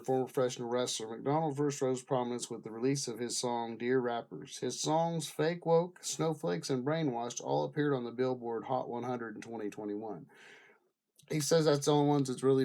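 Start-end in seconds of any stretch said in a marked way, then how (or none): none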